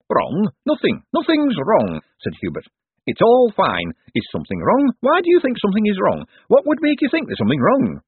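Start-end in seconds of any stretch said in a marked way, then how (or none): 0.1-2.0 high, jumpy protest; rattled and high-voiced; flustered denial. A narrator's reading. Hubert's flustered denial to Moist. 3.0-8.0 high, loud, rattled questions; agitated and defensive; panicky protest. A narrator's reading. Hubert's panicky run of questions.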